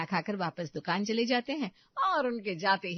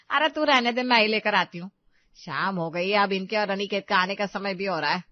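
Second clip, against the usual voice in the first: loud, brusque takeover; impatient mother-in-law; 8 dB louder than usual. Acted. Mother-in-law taking over the market errand, loud and impatient. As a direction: brush her offer aside loudly.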